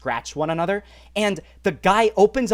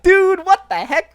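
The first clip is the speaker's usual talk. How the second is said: high, loud, drawn-out disbelief; mock shocked brother; 13 semitones higher, 9 dB louder, slower than usual. Brother to traveler at the search, high loud mock shock. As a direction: imitate a stunned brother, loudly stretching it out.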